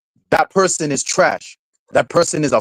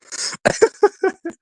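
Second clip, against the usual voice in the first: high squealing laugh. Real, not acted. Laughing at Mickey Mouse joke.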